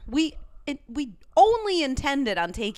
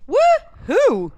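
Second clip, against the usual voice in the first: high, loud woo-hoo; excited cheer; 11 semitones higher, 7 dB louder than usual. Real, not acted. Co-host cheering the new season, excited high whoop. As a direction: whoop it out for the return.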